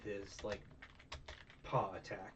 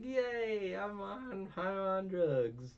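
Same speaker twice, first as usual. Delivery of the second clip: very high, slow, dreamy sing-song glee; giddy drugged-up cartoon voice; 10 semitones higher, 3 dB louder, much slower than usual. A narrator mimicking the character, sky-high and spaced out. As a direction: play him blissed out and loopy. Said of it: "he's just like".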